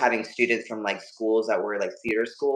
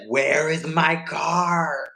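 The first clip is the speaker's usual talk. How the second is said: slow, higher, drawn-out mock outrage; mom demanding; 5 semitones higher, much slower than usual. Mom coming outside to find her car gone, mock outraged. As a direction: stretch each word like a stunned mom.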